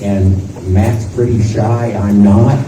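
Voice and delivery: usual speaking voice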